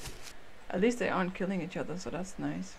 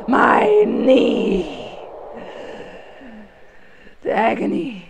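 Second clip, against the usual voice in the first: much louder hammed-up cry of pain; mock agony; 2 semitones higher, 12 dB louder, much slower than usual. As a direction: wail melodramatically. Mock injury wail.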